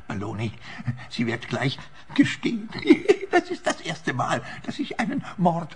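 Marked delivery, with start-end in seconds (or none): 2.7-3.3 high breathy burst of laughter; gleeful laugh. Acted. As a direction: laugh out in glee.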